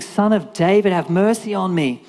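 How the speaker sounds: mock yell for mercy; imitated crying out. Blind Bart begging Jesus for mercy, crying out. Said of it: "he starts screaming out".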